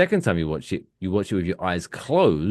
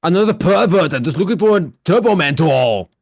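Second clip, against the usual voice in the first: Arnold movie quote; 8 semitones higher, 6 dB louder than usual. Acted. Movie quote from Jingle All the Way.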